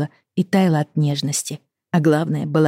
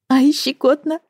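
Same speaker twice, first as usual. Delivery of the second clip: ticklish giggle; 11 semitones higher than usual. Acted. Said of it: "рассмеялась".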